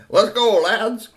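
high, loud creature command; squirrel gang leader. A narrator's reading. Meanie orders his gang off.

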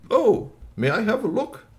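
high-pitched, eager alien request; polite alien. Acted. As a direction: ask sweetly in a high creature voice. Alien asking to help.

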